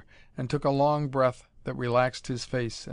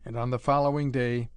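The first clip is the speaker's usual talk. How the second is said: ordinary delivery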